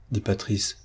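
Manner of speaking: ordinary delivery, nothing marked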